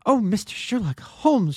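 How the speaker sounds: high, eager cry of relief; excited cry. A narrator's reading. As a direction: call out to him, bursting with hope. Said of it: "she cried".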